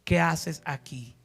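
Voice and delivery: God speaking gently to the listener; soft intimate question. Acted. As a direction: ask it softly, close to the ear. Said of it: "y te diga".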